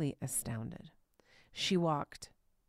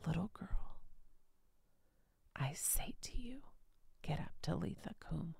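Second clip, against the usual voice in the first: tender whisper; 7 dB quieter than usual. Acted. Jesus's words repeated tenderly.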